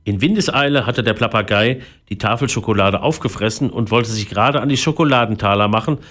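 plain reading voice

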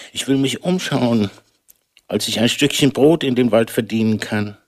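ordinary delivery

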